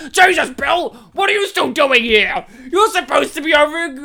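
high, loud, exasperated yelling at a late employee; furious boss shout. Retold or imitated. Elephant boss yells at Bill.

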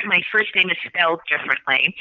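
usual speaking voice

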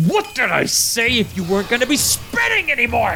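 high, furious scolding; angry yell at gnome. Acted. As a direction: yell at the slacker.

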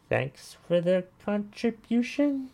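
slow, high-pitched, insincere thank-you; mock thanks. Real, not acted. Host thanking an angry commenter for his contribution, mock-sweet.

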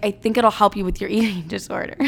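retold advice, laughing near the end; quote broken by laughter. Quoting mom on the Noom app, breaking into laughter. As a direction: crack up partway through the quote.

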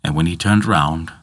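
plain reading voice

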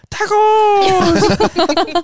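a crowd cheering a single word; excited group shout. Retold or imitated. Crowd yells tacos, retold.

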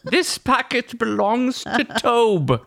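high, slow olde English proclamation; town crier. Pretend. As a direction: ring out like a town crier, laughing. Mock town crier announcement.

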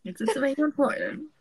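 half laugh, half sob, moved by her friend; crying along. Real, not acted. Host comforting her crying guest, tearful herself. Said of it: "da fange ich gleich mit an zu".

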